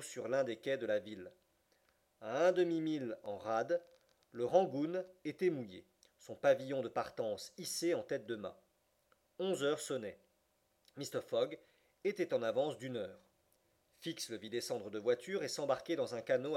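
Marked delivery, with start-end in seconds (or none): none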